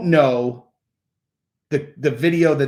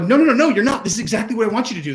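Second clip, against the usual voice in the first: higher, quickening mock insistence; imitated parent countering; 8 semitones higher, much faster than usual. Parent outwitting a rebellious child, high and increasingly fast. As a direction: contradict the child in a high rush. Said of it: "You're like".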